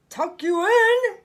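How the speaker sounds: pirate captain bellowing in disbelief; bellowed. Acted. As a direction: roar it in disbelief. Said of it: "he bellowed".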